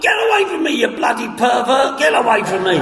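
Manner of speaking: high shout at the hugger; mock outraged yell. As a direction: yell him away, half in fun. Shoving off the hugger.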